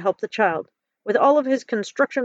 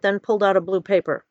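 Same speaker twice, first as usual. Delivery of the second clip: usual speaking voice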